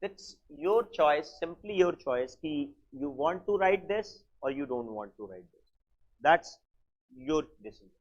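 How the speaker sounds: very slow, soft, each word stretched; teacher leaving the choice to the students; slow drawl. Real, not acted. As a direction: lay it out slowly, leaving it to them. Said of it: "this is my habit of talking like this".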